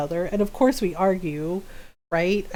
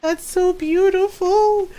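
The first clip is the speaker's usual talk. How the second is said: a high, slow, teary gush; mock tearful daughter; imitating crying daughter; 8 semitones higher, 4 dB louder, slower than usual. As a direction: mimic her choked-up awe, a touch overdone. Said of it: "Why are you crying?".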